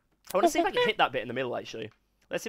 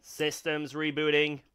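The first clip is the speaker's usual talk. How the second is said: slow, flat robot voice through a vocoder; 9 dB louder, much slower than usual. Pretend. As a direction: speak like a glitching machine. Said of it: "I'm gonna turn on the robot".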